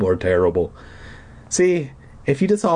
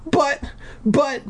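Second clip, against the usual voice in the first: sputtering. Acted. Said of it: "I sputtered".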